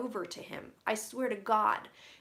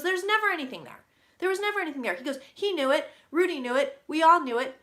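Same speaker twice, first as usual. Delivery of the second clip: higher, louder, emphatic insistence; imitating a loud denial; insistent retelling; 5 semitones higher, 8 dB louder, faster than usual. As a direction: hammer it home, louder each line. Spirit insists nothing in Burisma.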